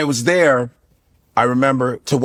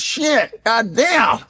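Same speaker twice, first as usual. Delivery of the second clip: Pryor impression; 9 semitones higher, much slower than usual. Pryor voice slips back in.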